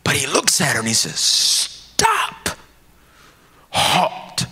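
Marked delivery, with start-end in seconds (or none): 1.2-4.5 1 urgent command. Acted. Jesus halts Mary.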